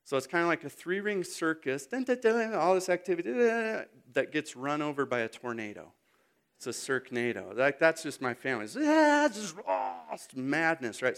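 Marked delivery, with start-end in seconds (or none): 1.8-2.5 high, rapid nonsense babble; frantic mock jingle; circus noise. Imitating busy circus family chaos. 3.3-3.8 high, rapid nonsense babble; frantic mock jingle; circus noise. Imitating busy circus family chaos. 8.8-10.0 high mock wails of kids; loud, high noise of mayhem; chaotic yelling. Imitating noisy children's madness.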